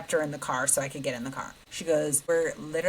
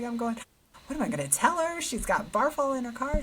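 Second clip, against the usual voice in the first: much higher, panicked; panicked daughter; 8 semitones higher than usual. Retold or imitated. Daughter panicking about telling the friend about the barf. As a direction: fret aloud about the ruined car.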